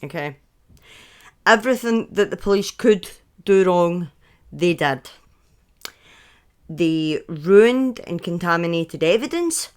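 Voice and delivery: ordinary talk, nothing marked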